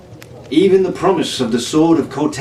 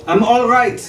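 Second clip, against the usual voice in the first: called up; 10 semitones higher than usual. Acted. Jean calls up from valley.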